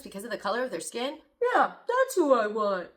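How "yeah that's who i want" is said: mock quote. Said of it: "mocking Trump supporter".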